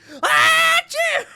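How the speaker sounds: loud high cartoon achoo; big shrieking cartoon sneeze. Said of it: "the cartoon sneeze".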